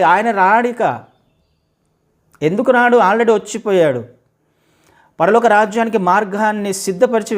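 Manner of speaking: loud, high, driving sermon voice; fiery preaching. Acted. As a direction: preach it out to the whole congregation. Preaching at full force. (గట్టిగా ఉద్వేగంగా బోధించడం)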